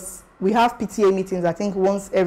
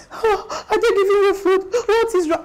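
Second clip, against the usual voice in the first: much higher, gasping mock wail; mock crying; 11 semitones higher than usual. Mom crying at first visit to her child, hammed-up tearful voice. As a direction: imitate a mother wailing in tears. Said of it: "As she was crying and saying".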